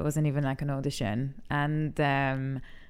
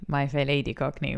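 ordinary delivery

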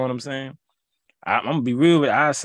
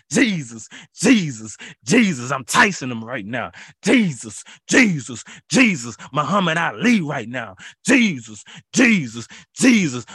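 fired-up ringside preaching; hype preaching; 2 semitones higher, 3 dB louder than usual. Acted. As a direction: preach like a fighter closing in. Boxing hype, chanting the name.

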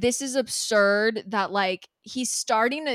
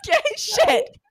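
high, loud laughing talk; 6 semitones higher, 8 dB louder than usual. Real, not acted. Laughing with her co-host about nearly holding it together. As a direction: giggle through the words, much higher and louder.

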